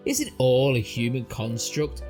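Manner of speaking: ordinary delivery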